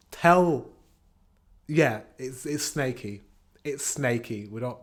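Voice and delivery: ordinary delivery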